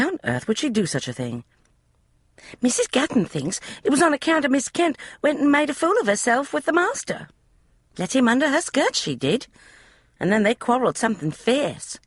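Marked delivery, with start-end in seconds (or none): 2.6-12.0 put-on high, eager young maid's voice; a chatty servant girl, pitched high; maid Polly. Maid Polly explaining the room to Emma, high eager voice. Polly gossips about master.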